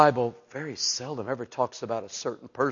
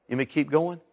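ordinary talk, nothing marked

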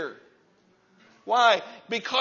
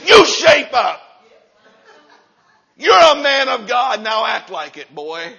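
barked self-command, higher and louder; mirror pep talk; 3 semitones higher, 9 dB louder than usual. Shown as a demonstration. Preacher yelling at himself in the mirror, stern. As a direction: scold yourself into shape. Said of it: "look yourself in the mirror and say".

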